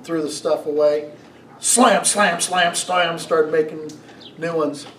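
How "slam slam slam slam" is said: mock slam sounds. Imitating barista's angry slamming.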